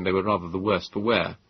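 plain reading voice

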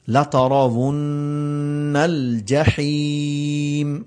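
slow chanted tilawat; melodic recitation. Acted. Teacher reciting the verse for his students.

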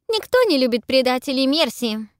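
loud, scornful anger; angry. A narrator's reading. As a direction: spit it out angrily. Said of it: "сердится Пиппа".

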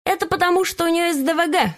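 high, sly tattletale parrot. A narrator's reading. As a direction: tell on her with a smirk. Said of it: "сообщил ученик-попугай".

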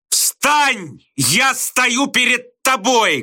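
high, booming teacher's command; Slushkin thundering. A narrator's reading. Teacher orders a slouching pupil up, thundering. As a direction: thunder it slowly at full pitch. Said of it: "грохотал Слушкин".

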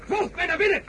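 high, forceful order to the troops; rallying command; commanding call. Acted. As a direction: lead the soldiers in. Ordering soldiers inside.

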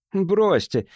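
high-pitched, mildly contemptuous brush-off; scornful dismissal. A narrator's reading. Jimmy brushing off the captain's six-minute objection, scornfully. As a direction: wave the objection away with scorn. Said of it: "презрительно отмахнулся он".

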